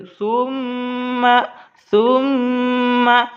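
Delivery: सुम्मा with a long hummed म; very slow सुम्मा, humming through the म; ghunna nasal hold. Shown as a demonstration. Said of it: "इसको गुन्ना करके पढ़े".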